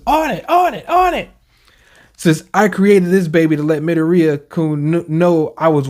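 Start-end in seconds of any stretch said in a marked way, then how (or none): 0.0-1.3 high eager voice. Retold or imitated.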